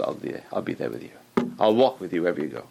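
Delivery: low, hushed promise; quiet, deep-pitched voice of comfort; tender reassurance. Retold or imitated. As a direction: reassure softly and steadily. God reassures the believer.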